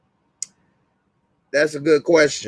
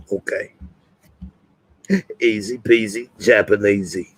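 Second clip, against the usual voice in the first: Butcher impersonation; 3 semitones lower than usual. Pretend. Streamer asked to rate his Butcher, low mock-Cockney orders. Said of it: "Y'all think I do a good Butcher impersonation?".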